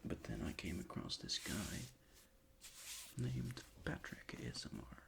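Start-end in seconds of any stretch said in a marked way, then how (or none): none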